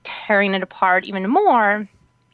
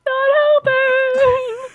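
high, drawn-out tearful wail; mock crying; 13 semitones higher, 5 dB louder, much slower than usual. Crying traveler to the agent screaming at her, mock wail. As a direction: wail it long and loud, hammed up. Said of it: "crying after the whole".

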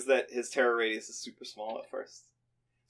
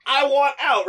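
high, loud, stretched-out plea; frantic plea; 11 semitones higher, 7 dB louder, slower than usual. Retold or imitated. Trapped player begging the blocker to move, frantic plea. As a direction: frantically insist on being let out.

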